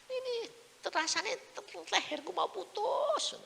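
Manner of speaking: very high, drawn-out wail of pain; suffering cry. Retold or imitated. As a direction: imitate agonised complaining in a high voice. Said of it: "jam dua dia bilang". (rintihan kesakitan bernada tinggi)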